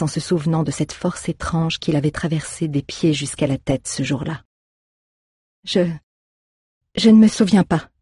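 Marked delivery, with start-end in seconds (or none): 5.6-7.9 higher, louder frightened stammer; stammering lie. A narrator's reading. Scared girl stammering a lie to the druid. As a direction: stumble over the words, caught out. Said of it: "mentit-elle en bafouillant".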